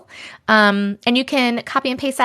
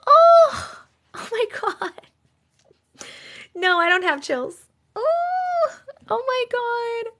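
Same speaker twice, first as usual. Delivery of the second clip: squealing much higher, close to tears; overwhelmed; 11 semitones higher, slower than usual. Real, not acted. Streamer overwhelmed by the ghost story ending, squealing. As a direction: let it burst out high and trembling.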